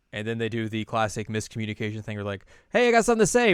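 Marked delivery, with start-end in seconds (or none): 2.7-3.5 eager blurt. Retold or imitated. Both blurt at same time.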